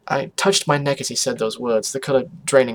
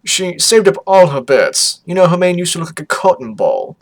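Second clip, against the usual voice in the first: higher, louder parent voice; reminiscing parent character; 5 semitones higher, 8 dB louder than usual. Acted. Parent embarrassing a daughter, gushing character voice. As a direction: play a parent gushing over childhood stories.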